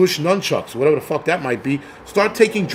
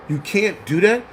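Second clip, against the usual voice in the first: ordinary talk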